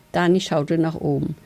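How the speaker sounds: ordinary delivery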